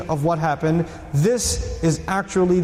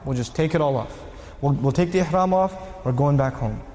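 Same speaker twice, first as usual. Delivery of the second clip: sullen resignation; 6 semitones lower than usual. Acted. Companions sullenly give in.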